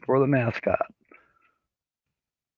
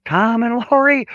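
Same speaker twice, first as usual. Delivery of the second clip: excited; 10 semitones higher, 3 dB louder than usual. Real, not acted. Big names join the live chat.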